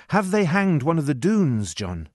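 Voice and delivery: high-pitched, anxious question; mildly alarmed boy. A narrator's reading. Boy questions servant about a hanged man, mild alarm.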